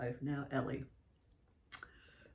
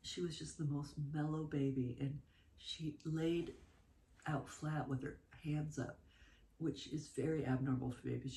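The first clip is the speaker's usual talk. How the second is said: normal talking voice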